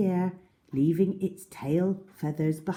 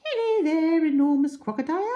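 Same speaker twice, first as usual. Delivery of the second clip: very high, lilting bird call; roly-poly bird singing hello; sing-song bird; 13 semitones higher, 5 dB louder, faster than usual. Acted. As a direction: chirp a cheerful greeting. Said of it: "sang the roly-poly bird".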